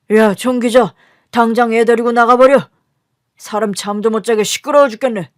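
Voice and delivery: loud, irritated yelling; angry father. Acted. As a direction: yell at the child to clear out. Father yells over crying baby. (짜증 난 아버지의 고함)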